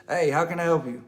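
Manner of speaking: loud clear greeting; calling on speaker. Retold or imitated. He speaks loudly to the caller on speaker. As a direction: project it at the speakerphone.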